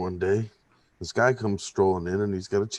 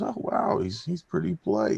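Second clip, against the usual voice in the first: higher, breathy wow then impressed; amazed bartender; 9 semitones higher than usual. Acted. Bartender impressed by the polite chimp, higher voice. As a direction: lift up into surprise.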